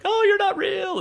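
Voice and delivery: sudden high panicked yelp; freaking out. Retold or imitated. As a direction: shriek it in disbelief. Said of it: "he's, like, freaking out".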